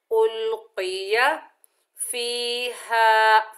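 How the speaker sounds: slow recitation with heavy qaf. Shown as a demonstration. Teacher reciting उल्किया फीहा. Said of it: "मोटा अदा होगा".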